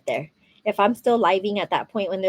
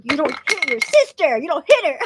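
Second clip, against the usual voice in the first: mock mom scolding; 6 semitones higher, 4 dB louder than usual. Imagined scolding of her child.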